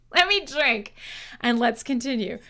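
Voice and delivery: usual speaking voice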